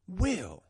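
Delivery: "Will" alone, high and falling; emphasis on WILL. Real, not acted. Host repeats the key word, high emphatic Will. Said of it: "put the emphasis on that word".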